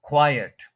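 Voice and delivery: "क्वाइट" as KWAI-et, loud first syllable; both syllables spoken. Shown as a demonstration. Teacher proving both syllables are there, stressed two-syllable pronunciation. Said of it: "दोनों syllables बोले गए हैं".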